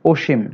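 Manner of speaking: অসীম starting on rounded o, "oshim"; o-like vowel. Shown as a demonstration. Teacher giving the correct example, o-vowel অসীম. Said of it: "ওকারের মতো হয়".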